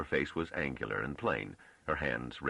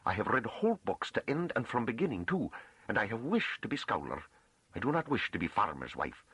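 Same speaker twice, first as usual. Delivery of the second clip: high, insistent farm woman voice; driving on fiercely; 8 semitones higher than usual. A narrator's reading. Farm woman insists to the master she will be a scholar, fiercely. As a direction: press the case hard in rustic dialect. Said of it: "Sura Novi drove on fiercely".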